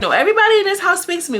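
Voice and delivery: high, loud, fast scolding; scolding rant. Retold or imitated. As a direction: tear into her fast. Jocelyn scolding the housemate.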